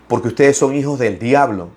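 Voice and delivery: ordinary talk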